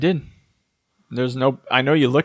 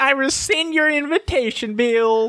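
high, hammed-up Louisiana belle; mock Southern accent; 13 semitones higher, slower than usual. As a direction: shriek it like a bad TV Southern accent. Parodying True Blood scene.

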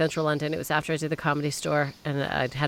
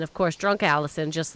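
usual speaking voice

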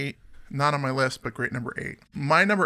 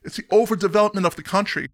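Australian accent attempt; 6 semitones higher, 4 dB louder than usual. Host reading the band's quote in an Aussie accent. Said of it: "I get into the [name] speak when I start going Australian".

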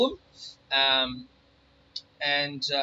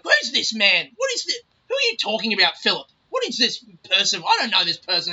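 confused grandma; 4 semitones higher, 5 dB louder than usual. Retold or imitated. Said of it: "she's like".

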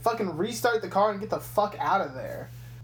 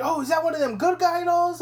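higher, louder, gushing cop; excited cop voice; 9 semitones higher, 4 dB louder than usual. Retold or imitated. A traffic cop gushing over the doll, higher and eager. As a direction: play the cop as a starstruck fan.

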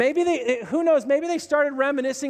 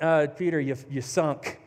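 a hint of smug John; dry put-down; 7 semitones lower than usual. John mocking Peter for sinking, dry deadpan put-down.

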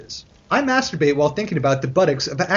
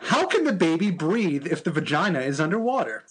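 ordinary delivery

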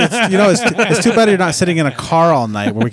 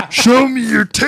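high, slow, hammed-up creep voice; creepy guy voice; 10 semitones higher, 4 dB louder, slower than usual. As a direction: play a leering creep, high and drawn out. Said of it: "It's the one he types it".

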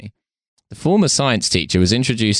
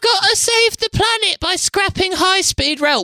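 mocking climate activist. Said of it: "sound the big fucking climate idiot alarm".

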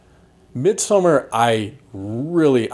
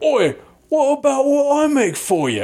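much higher, hammed-up old pirate voice; pirate dialect. Willem Dafoe's keeper snapping at Pattinson, mock pirate dialect. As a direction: squawk it like a cranky old sea dog. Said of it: "whatever weird pirate dialect he's using".

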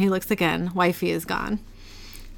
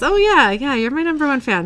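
higher, hurried-to-agree placating tone; humouring a fan; 6 semitones higher, 5 dB louder than usual. Acted. Author placating his number one fan, humouring. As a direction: agree eagerly to keep the fan on side.

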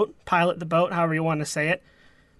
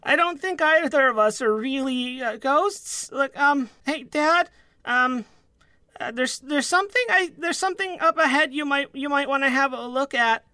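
put-on higher-pitched, stammering young deckhand; hesitant son; 5 semitones higher than usual. First mate interrupting his dad to point something out, hesitant. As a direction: play an awkward son, voice pitched up and halting. Game master voices Byford's timid son.

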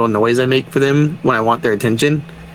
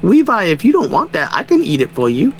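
put-on high, sing-song little kid asking; mock kid voice, pitched up; imitated young son; 7 semitones higher than usual. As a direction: wheedle sweetly like a hungry child. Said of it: "my other son is like".

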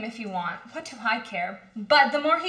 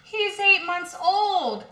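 exasperated; 8 semitones higher, 4 dB louder, slower than usual. Acted. Mother scoffs at father's claim.

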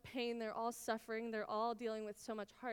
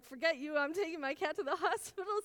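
retold frantic outburst; 6 semitones higher, 7 dB louder than usual. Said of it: "I was like".

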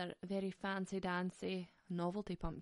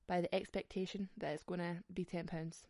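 ordinary talk, nothing marked

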